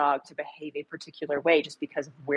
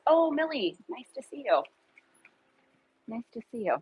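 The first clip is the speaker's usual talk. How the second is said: sweet greeting; 6 semitones higher than usual. Real, not acted. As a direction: greet the little dog fondly. Greeting her dog sweetly.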